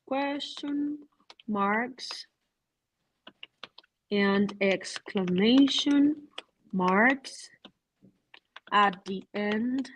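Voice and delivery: very slow, spaced out; slow dictation. Shown as a demonstration. Teacher slowly dictates the rule to the class. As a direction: spell out the rule word by word. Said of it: "teacher dictates the rule slowly".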